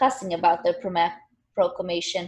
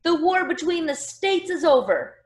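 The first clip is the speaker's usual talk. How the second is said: higher-pitched, restrained orator's shout; mild narrated shout; 8 semitones higher, 3 dB louder than usual. A narrator's reading. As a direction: announce it as if to a crowd, without full force. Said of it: "Mister McPherson shouted".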